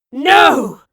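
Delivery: very loud desperate cry; both cry out to stop the Janes; cried. Acted. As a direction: scream it out in horror. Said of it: "cried the professor and Raleigh together".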